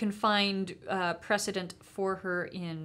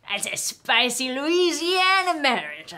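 mock Louisiana accent; 5 semitones higher, 8 dB louder than usual. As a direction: holler it like a Louisiana political brawler. Riffing as Louisiana couple Carville and Matalin.

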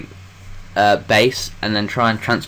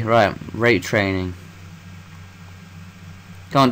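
ordinary delivery

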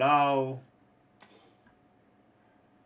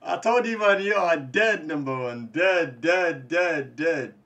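loud chanting of dead, dead; gloating shout; 5 semitones higher, 8 dB louder than usual. Real, not acted. Streamer shouting at a beaten rival, gloating. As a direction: hammer it home, loud and cocky.

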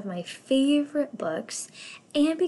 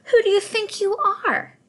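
high, louder proud outburst; indignant cry; 12 semitones higher, 6 dB louder than usual. Acted. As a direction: snap back at the little fish. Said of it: "cried the Rainbow Fish".

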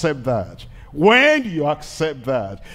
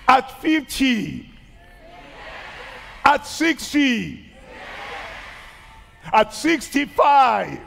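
fervent preaching; 9 semitones higher than usual. Real, not acted. Preaching climax listing ages.

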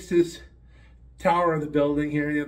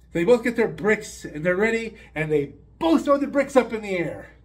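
ordinary talk, nothing marked

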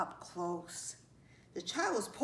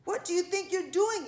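high, loud scolding; indignant yell; 8 semitones higher, 6 dB louder than usual. A narrator's reading. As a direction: yell it right into someone's ear. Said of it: "it yelled into its ear".

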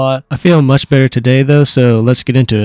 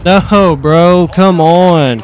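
frustrated; 5 semitones higher, 4 dB louder, much slower than usual. Real, not acted. Dies again in a gunfight.

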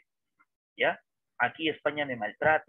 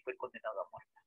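dropped much softer, almost to itself; a quiet, sombre echo; soft repeat; 5 semitones lower, 11 dB quieter than usual. Shown as a demonstration. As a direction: let it fall away quietly.

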